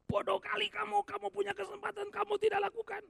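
high, loud contemptuous scolding. Acted. People scolding a foolish one, high and contemptuous. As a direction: berate someone for a wasted chance.

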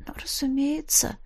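faint whispered reply; barely audible whisper; soundless whisper. A narrator's reading. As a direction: mouth it with almost no voice. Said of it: "будто бы сказала я".

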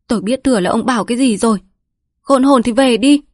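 nói nhanh rồi quát to, cao; đuổi khéo rồi gắt; gắt lên. A narrator's reading. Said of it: "làm gì mà gắt lên thế". (mụ Nghĩa gắt đuổi Quất về)

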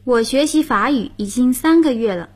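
ordinary delivery

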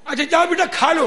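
loud, high, acted permission. Guru telling his excuse-making disciple to eat, loud high call. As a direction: sing out the invitation.

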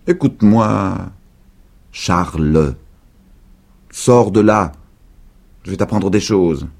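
voix de vieille un peu plus forte; vieille dame insistante. A narrator's reading. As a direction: convaincre avec insistance. Vieille dame persuade Charlie. (la vieille dame insiste pour que Charlie sorte)

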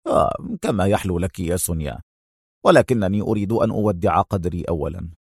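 low, soft shrug of a reply; indifferent. A narrator's reading. Adham giving in to Sonia, indifferently. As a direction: answer as if it hardly matters, low and quiet. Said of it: "هز كتفيه في لا مبالاة وهو يقول".

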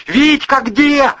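high loud yell into an ear. A narrator's reading. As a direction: bellow it over the roaring sea. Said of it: "прямо в ухо завопил брат".

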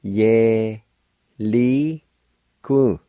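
teacher breaking down "Ye, li, ku"; sounded out. Shown as a demonstration. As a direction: pause after each of "Ye", "li", "ku". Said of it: "First part's pronounced like this".

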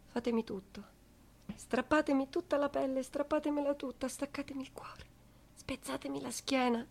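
soft, near whispered pleading; hushed plea. Acted. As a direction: pull it down to a broken hush. (supplica sussurrata, molto piano)

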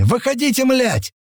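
high, urgent-sounding yell. A narrator's reading. Men calling their friends out, yelling. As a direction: holler it way up high. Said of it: "заорали мы, размахивая над головами".

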